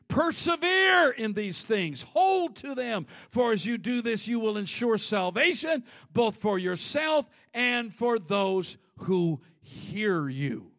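loud, slow, driving preacher's read; booming motivational speech. Acted. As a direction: proclaim each command slowly and firmly. Preaches scripture with force.

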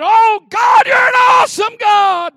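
Elijah's praise at full volume; shouted praise. Acted. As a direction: cry out in awe. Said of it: "And he said".

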